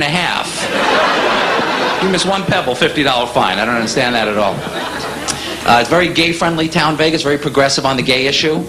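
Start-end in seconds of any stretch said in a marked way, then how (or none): none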